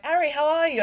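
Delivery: high, loud mock British greeting; British accent. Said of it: "doing my little British accent".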